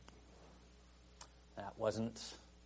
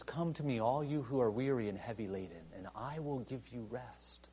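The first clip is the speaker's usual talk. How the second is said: softly reassuring promise; tender quiet invitation; 7 dB quieter than usual. Acted. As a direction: fade to a tender hush. Quiet contrasting retake.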